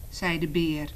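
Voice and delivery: ordinary delivery, nothing marked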